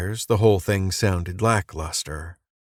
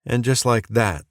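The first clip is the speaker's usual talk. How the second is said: usual speaking voice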